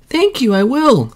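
put-on high child calling back. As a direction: call back gladly across the water. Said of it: "called the child".

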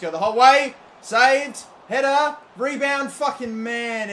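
loud, fast, high calling of the action; excited play-by-play. Real, not acted. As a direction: call the chances as they happen. Live attack in game.

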